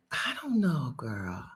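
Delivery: breathy unvoiced whisper of doubt. Real, not acted. Unsure of accent's future, she whispers aside. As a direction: drop to a whisper, half to herself.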